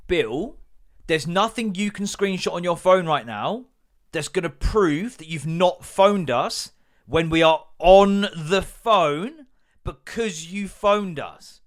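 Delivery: exasperated rant. Retold or imitated. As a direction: spell it out to him, losing patience.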